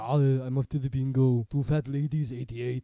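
very loud hammed-up Arnie; Arnie impression. Joking that the villain goes to bingo, booming Arnie impression. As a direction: boom it out like a bingo-calling Arnie. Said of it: "The biggest can of Arnie".

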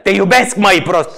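loud, high yelled insult-endearment; mock yelling. Imitating the man's partner declaring love, yelling. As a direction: yell affection like a threat. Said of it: "Știi că ea și urla".

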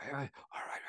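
scene: what Miles should have said after surgery, hushed. Retold or imitated. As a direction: say it almost under the breath. Miles should have stayed quiet.

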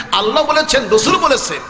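high, intense sermon chant; full-force preaching. Acted. Preacher tells of God showing mother paradise, high fervent preaching. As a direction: proclaim it high, phrase by phrase.

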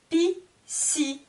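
"Piscine" as Pi... sci... ne, separated; split into syllables. Shown as a demonstration. Teacher locating the sound i, "Piscine" cut in three. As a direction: pause after each high beat of "Piscine". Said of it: "Il y a trois syllabes".

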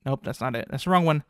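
ordinary talk

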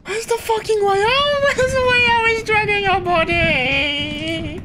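high panicked squealing; scared horror game streamer. Real, not acted. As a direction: panic like something's right behind you. Fleeing monster, high pitched panic.

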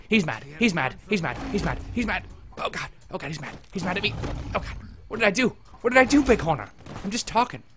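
high, loud frantic repeated cries; panicked yelling. Real, not acted. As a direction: panic as the bull charges you. Panicking as an angry bull charges.

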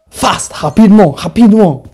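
loud frantic urging in French; panicked urgent shout. Real, not acted. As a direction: yell at them to hurry. Fleeing the monster.

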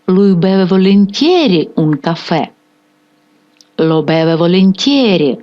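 volentieri carries the main stress, lo stays light and unstressed. Shown as a demonstration. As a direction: lean on volentieri and glide over lo. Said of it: "Наголос падає на слово volentieri".

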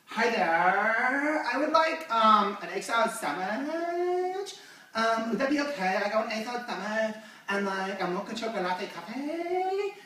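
drawn-out words with endings swooping upward; mock an irritating customer, stretching each phrase; exaggerated, dragging girly order; annoying coffee-shop girl. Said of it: "this is how she really spoke".

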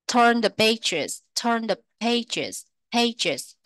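"pages" with a puff of air on the p; bật hơi âm p trong "pages"; aspirated p in pages. Shown as a demonstration. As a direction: breathe out hard on the "p" of "pages". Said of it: "Âm phờ các bạn bật hơi nhá".

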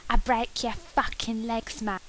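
mock London chav threat; rough London slang. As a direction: growl it like a London lout. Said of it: "speak like a chav".

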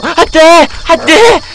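high, loud mock-panicked cries; acted panic. Shown as a demonstration. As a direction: demonstrate someone flustered, wailing it out. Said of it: "이렇게 당황하는".

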